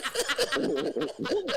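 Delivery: high-pitched real laugh. Real, not acted. Joking about going straight to bed, laughing.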